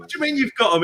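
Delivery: high questioning disbelief; incredulous. Retold or imitated. Pressing Rocky on the phone, high and incredulous. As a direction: press him, voice up in amazement. Rocky says he has the records.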